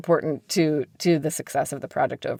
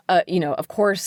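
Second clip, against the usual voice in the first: normal talking voice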